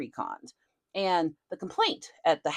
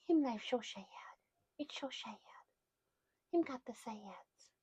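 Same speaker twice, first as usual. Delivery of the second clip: soft whispered mock pity for the dog; 7 semitones higher, 10 dB quieter than usual. Real, not acted. Owner mock-pitying the dog, whispered baby talk.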